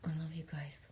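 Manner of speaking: soft, hushed whisper of affection; whispered love. Real, not acted. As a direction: breathe it out tenderly to your listeners. Tender sign-off to viewers.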